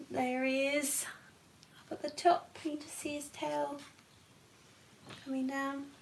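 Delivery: hushed whisper so the lion stays asleep. Real, not acted. Whispering to the listener near the sleeping lion. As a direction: breathe it, don't wake him. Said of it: "unvoiced whisper".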